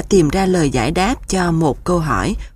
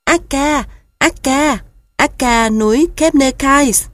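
pitiful goslings; 7 semitones higher than usual. A narrator's reading. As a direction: call out like a tired little goose. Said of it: "Các ngỗng con kêu lên, giọng đáng thương". (giọng ngỗng con đáng thương)

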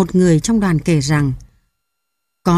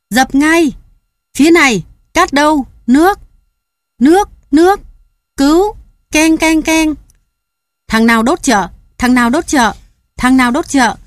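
very high, loud frantic shouting; crowd yelling; 8 semitones higher, 5 dB louder than usual. Acted. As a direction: scream orders at the fire. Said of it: "tiếng la hét, tiếng kêu cứu".

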